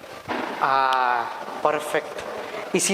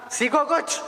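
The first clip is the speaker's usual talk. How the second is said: higher, rising, smug "सीखो कुछ"; smug boast; 7 semitones higher, slower than usual. Acted. Boss devil showing off to minions, smug and high. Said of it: "ऐसे किया जाता काम".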